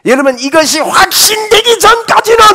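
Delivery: high, loud preaching cry. Real, not acted. Preacher crying out to the congregation, loud and high. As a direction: ring it out high and strong.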